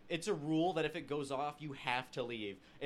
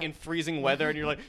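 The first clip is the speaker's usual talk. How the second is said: usual speaking voice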